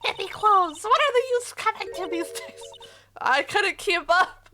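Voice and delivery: put-on high, disapproving old woman; old lady voice. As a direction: scold like a fussy grandma. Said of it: "my old lady voice".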